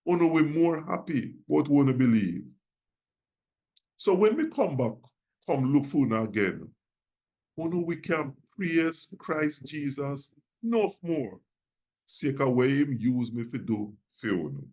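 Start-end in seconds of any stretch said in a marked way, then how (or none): none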